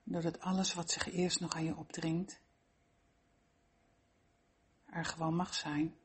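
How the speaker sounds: ordinary delivery, nothing marked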